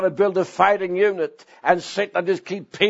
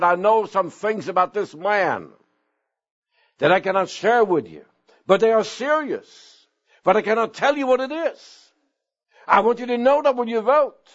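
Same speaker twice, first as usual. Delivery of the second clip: rising, higher mock-solemn warning to the church; the missionary's grave hint, pitched up; quoting the area missionary; 6 semitones higher than usual. Missionary warning the congregation about the preacher, pitched-up solemn voice. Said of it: "he told the congregation this".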